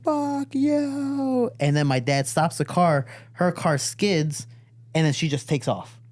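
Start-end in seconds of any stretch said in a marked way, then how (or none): 0.0-1.5 high, drawn-out mock yell; long high-pitched retold curse; furious mom curse. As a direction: imitate mom cursing dad.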